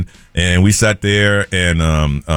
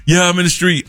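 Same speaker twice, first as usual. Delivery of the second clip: lightly voiced son, higher pitch; son on phone; 8 semitones higher than usual. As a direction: imitate a son answering his dad, higher. Son answers dad on phone.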